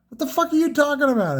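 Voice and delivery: high-pitched incredulous mock outburst. Larry to his partner in China about research, mock incredulous. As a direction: imitate a baffled, exasperated business partner, voice jumping up.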